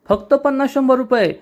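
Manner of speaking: high, indignant disbelief; indignant outrage. A narrator's reading. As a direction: scoff at the small sum, pitch leaping up. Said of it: "Kanha चिडून म्हणतो". (उंच आवाजात चिडलेला अविश्वास)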